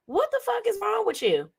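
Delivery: squeaked-up, incredulous question; high-pitched disbelief. Acted. Blogger questioning Jacqueline's butler act, high-pitched disbelief. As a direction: pitch it way up in mock bafflement.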